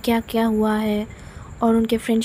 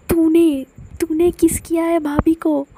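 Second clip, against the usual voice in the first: shocked; 5 semitones higher than usual. Acted. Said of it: "पूरा shock हो जाती है".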